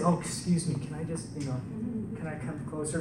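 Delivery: hushed, hesitant request to strangers; timid quiet asking. Retold or imitated. Tax collector timidly asking the crowd to let him closer, hushed. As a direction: shrink back and ask meekly.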